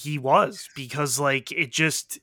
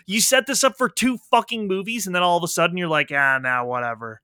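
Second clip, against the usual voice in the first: mock rant at writers; 2 semitones higher, 7 dB louder than usual. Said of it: "and I was just like".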